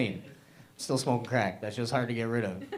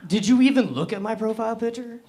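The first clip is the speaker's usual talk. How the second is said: higher, louder mock outrage; mock indignant; 11 semitones higher, 6 dB louder than usual. Comic replying to a mean Tinder message, mock indignant. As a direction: fire back at the insult, half-joking. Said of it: "I was kinda mad".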